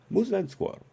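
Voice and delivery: a hammed-up spy villain voice; mock Russian accent, high and comic. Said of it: "lose the accent".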